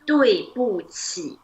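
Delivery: drawn-out syllables for a learner; slow model phrase. Shown as a demonstration. Teacher introduces the apology to student, slow model. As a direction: say each syllable slowly and separately.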